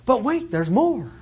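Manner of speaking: playful mock infomercial pitch; infomercial pitchman. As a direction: hype it up like a TV salesman, half laughing. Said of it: "kinda like one of those infomercials".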